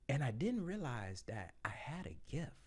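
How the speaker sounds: ordinary talk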